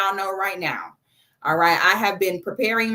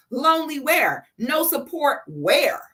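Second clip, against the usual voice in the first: sassy; 6 semitones higher than usual. Retold or imitated. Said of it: "They were like".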